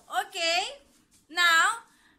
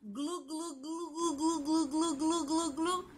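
soft bubbly glugging; imitated fish bubbles; 6 dB quieter than usual. Shown as a demonstration. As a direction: glug rhythmically like a fish underwater. Fish sound before naming fish.

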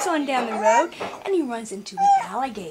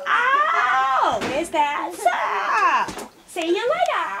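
mock surprise; 5 semitones higher, 5 dB louder, slower than usual. Frog reacts to squirrel.

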